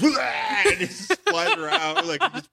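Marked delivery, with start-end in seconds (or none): 0.0-0.1 sudden comic barf cry, "wah"; imitated vomit noise. Imagining him throwing up in space, mock retching yell. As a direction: mimic someone heaving explosively. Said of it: "goes up into space and he goes".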